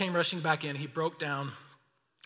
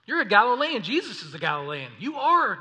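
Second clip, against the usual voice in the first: higher, louder accusation of the crowd; pointing the finger, raised voice; accusing shout; 5 semitones higher, 7 dB louder than usual. Acted. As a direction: call him out like an angry bystander. Bystanders accusing Peter aloud.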